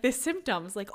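ordinary delivery

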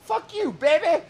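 high, loud rock-singer shout; imitating the singer. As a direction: belt it like the band's frontman. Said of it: "they go back into the".

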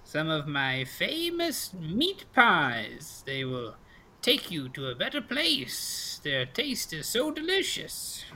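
slow, high, enticing crone; old pastry seller. Acted. Old lady pitches meat pies, slow enticing crone voice. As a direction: tempt them with long swooping words.

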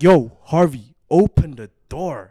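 loud, insistent call at the door. Retold or imitated. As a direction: call out firmly for Harvey. Repeating demand at door, louder.

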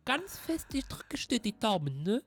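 teasing mock encouragement. Teasing friend about driving licence.

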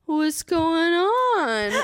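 long, slow drawn-out question; imitating an easygoing nurse; chill nurse. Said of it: "He was the chillest dude".

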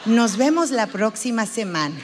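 composed counsellor closing the session; psychologist wrapping up. Acted. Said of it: "Si acaba la hora y te dice".

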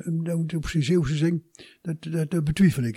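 usual speaking voice

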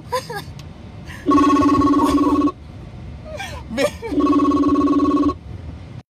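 long breathless laughing fit; breathless fit of laughter. Real, not acted. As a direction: crack up helplessly. Laughing at the guest.

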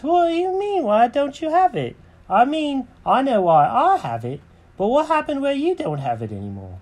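very high-pitched cartoon cat; Jenkins questioning. Cat questions the birds about his house, very high voice. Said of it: "Jenkins goes".

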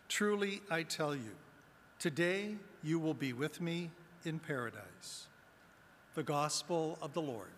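normal talking voice

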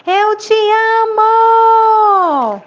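high, loud, long-held echo; echoed shout. A narrator's reading. Mountain echo repeating the father's love, long high shout. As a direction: return the call, stretching the last word. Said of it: "A voz de novo falou".

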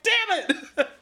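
high-pitched mock cursing; hammed-up exasperated cry; pissed-off character. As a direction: burst out in comic frustration. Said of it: "characters get pissed off".

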